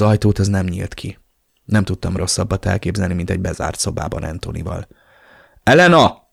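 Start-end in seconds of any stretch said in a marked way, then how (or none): 5.6-6.2 loud, high call; yelling. A narrator's reading. Narrator yells for sister through locked door, loudly. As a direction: yell the name after someone leaving. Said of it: "Üvöltöttem".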